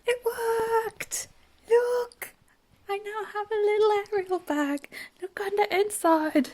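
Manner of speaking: high, delighted squeal of success; thrilled it actually worked; excited. Real, not acted. Vlogger showing viewers her finished Ariel bag, excited.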